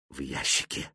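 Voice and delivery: very low, soft, weak voice; very old faint voice. A narrator's reading. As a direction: barely push the words out. Dying swamp man answers.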